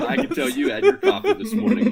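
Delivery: laughing high and loud through the words. Real, not acted. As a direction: laugh it out at your buddy. Laughing at cohost's outburst.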